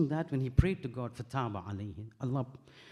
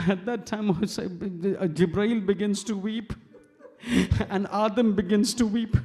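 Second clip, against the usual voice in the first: raised, grieving preacher's voice building into sobs; louder, higher mourning cry breaking into sobs; tearful lament; 6 semitones higher, 7 dB louder than usual. Real, not acted. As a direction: preach the martyrdom through rising tears. Tearful lament in majlis sermon.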